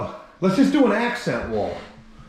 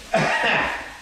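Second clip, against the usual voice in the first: short breathy chuckle; quick laugh to himself; 5 semitones higher than usual. Real, not acted. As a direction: let out a brief, amused chuckle. Laughs at his own remark.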